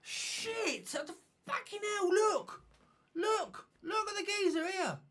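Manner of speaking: high-pitched yelp of shock, then pointing it out; shocked outburst. Real, not acted. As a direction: jump out of your seat in surprise. Spots something in the video.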